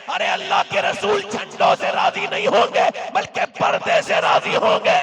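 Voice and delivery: high, shouted admonition with pauses between phrases; full-force preaching. Real, not acted. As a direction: thunder a rebuke at the congregation.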